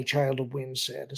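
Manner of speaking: plain reading voice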